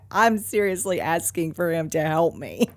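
ordinary delivery

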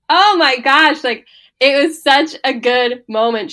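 high, loud burst of awe; gushing amazement. Retold or imitated. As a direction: gush it, thrilled. Gushing reaction to a scene.

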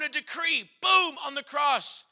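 shout. Acted. Proclaiming the decree.

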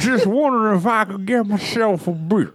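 Bill Cosby impression. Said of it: "Bill Cosby".

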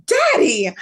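high, loud whiny cry of daddy; mock protest. Daughter protesting to her father, high and loud.

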